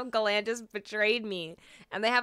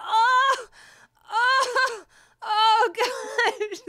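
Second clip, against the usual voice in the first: overcome cry; 12 semitones higher, 8 dB louder, much slower than usual. Real, not acted. Overwhelmed by an emotional song.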